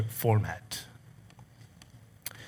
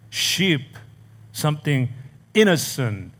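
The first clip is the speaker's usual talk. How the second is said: ordinary talk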